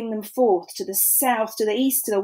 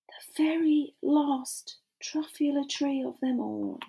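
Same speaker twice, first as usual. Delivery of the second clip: whispered lament; 5 semitones higher, 8 dB quieter than usual. Acted. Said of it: "sad ending whispered".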